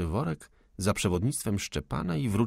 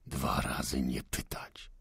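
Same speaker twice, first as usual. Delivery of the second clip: low, slow, breathy soldier's threat; menacing threat; 7 semitones lower, 7 dB quieter, slower than usual. Acted. Armed insurgent warns the young housekeeper, low and menacing. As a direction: hiss the warning at her slowly.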